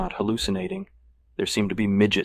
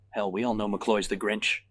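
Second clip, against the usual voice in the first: ordinary delivery, nothing marked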